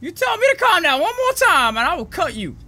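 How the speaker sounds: loud mock threat; angry threat. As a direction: snap back, threatening. Threatens after told calm down.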